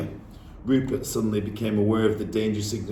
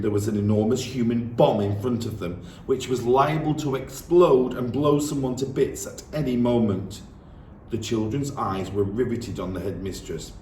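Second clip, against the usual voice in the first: plain reading voice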